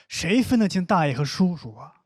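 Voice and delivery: ordinary delivery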